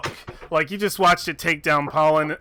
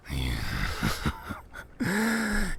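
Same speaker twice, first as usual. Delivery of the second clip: deep, quiet gloating laugh; a beast's soft amused rumble; low monster chuckle; 11 semitones lower, 6 dB quieter than usual. Acted. Manticore laughs at Abadara's plea, deep gloating chuckle.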